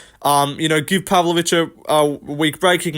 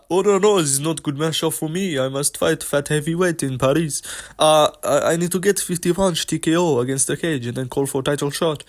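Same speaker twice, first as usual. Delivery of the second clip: broken English with a mock French accent. Mocking a fighter's voice in a mock French accent.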